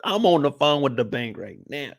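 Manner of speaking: ordinary talk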